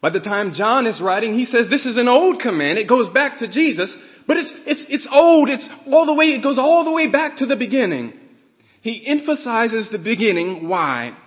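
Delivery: loud, high, fervent preaching; preaching at full force. Real, not acted. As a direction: build it up and hammer each phrase.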